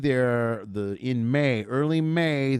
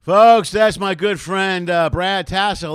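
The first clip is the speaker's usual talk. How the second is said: loud, higher, stretched hype intro; big announcement; 5 semitones higher, 12 dB louder, slower than usual. Real, not acted. Host hypes up his comedian guest to viewers, loud. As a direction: sell the guest like a ring announcer. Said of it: "host announcing guest".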